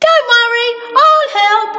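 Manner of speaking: high, sweet voice; Little Miss Magic. Acted. Little Miss Magic offers help, high sweet voice. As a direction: sparkle it out. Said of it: "said Little Miss Magic".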